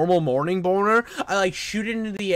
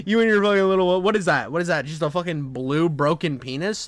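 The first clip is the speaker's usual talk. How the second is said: ordinary delivery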